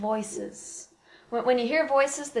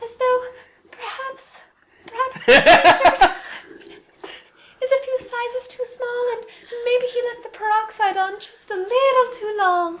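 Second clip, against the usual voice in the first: delicate, high, airy bimbo voice; breathy blonde girl; 11 semitones higher than usual. Shown as a demonstration. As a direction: play a ditzy blonde, breathy and pitched way up. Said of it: "very delicate and breathy and blonde sounding".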